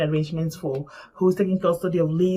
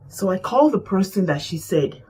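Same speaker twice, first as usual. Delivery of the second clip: ordinary delivery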